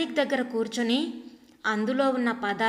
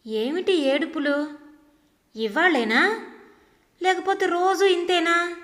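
frowning, louder, sharply rising demand; irritated daughter-in-law; 6 semitones higher, 4 dB louder than usual. Acted. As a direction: scold her, frowning and annoyed. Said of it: "నొసలు చిట్లించి అంటున్న". (కోపంగా నొసలు చిట్లించి అడిగే కోడలు)

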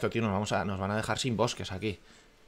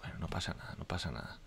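whispering; 5 semitones lower, 12 dB quieter than usual. Real, not acted. Calms himself over losses.

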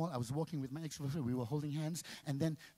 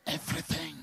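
normal talking voice

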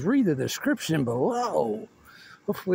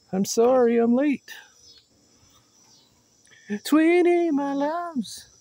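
high sweet greeting; 10 semitones higher, 4 dB louder than usual. Pretend.

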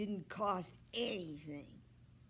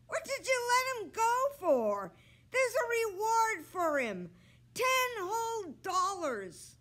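much higher, loud scolding yell; angry shout; 14 semitones higher, 8 dB louder than usual. A narrator's reading. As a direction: yell at your sister for losing the reward. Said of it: "Joe shouting to his sister".

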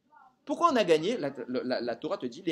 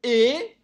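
"et" set apart, drawn out and raised; a long, emphatic "et" before going on; stress on ET; 7 semitones higher, 9 dB louder than usual. Shown as a demonstration. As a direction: lean hard on the word "et". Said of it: "pas ou".

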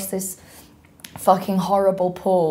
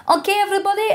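high, sing-out rep in a bad accent; mock the entertainer's high rallying call; yelling resort rep; 12 semitones higher, much faster than usual. Resort rep rallying the guests, high accented call. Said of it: "that's a very bad accent".

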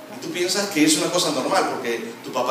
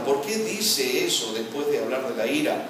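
normal talking voice

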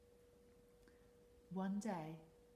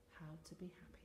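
whispering; 10 dB quieter, faster than usual. Acted. Octopus advice fades.

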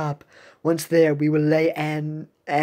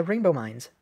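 usual speaking voice

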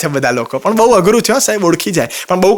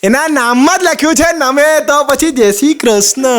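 slow punchline; 6 semitones higher, slower than usual. Real, not acted. Punchline delivered slowly.

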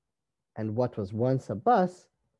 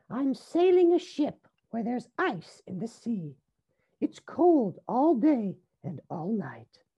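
high, lilting character voice; little rabbit Rosie; 10 semitones higher than usual. A narrator's reading. Rosie sails her pretend ship, high character voice.

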